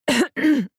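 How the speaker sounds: sharp high nervous cough; awkward cough. A narrator's reading. Silas coughing awkwardly before Iris. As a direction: cough to cover embarrassment.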